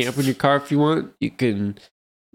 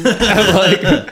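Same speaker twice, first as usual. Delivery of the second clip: laughing; 9 semitones higher, 9 dB louder than usual. Real, not acted. Laughing while retelling.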